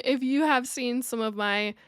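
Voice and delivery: ordinary talk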